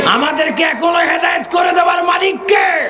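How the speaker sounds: high preacher's shout to the crowd; sustained high rallying call; rousing shout. Real, not acted. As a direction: cry out the question for a response.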